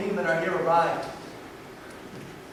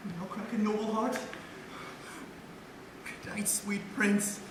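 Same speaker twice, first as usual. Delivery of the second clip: grieving; 11 dB quieter than usual. Acted. Horatio mourns dead Hamlet.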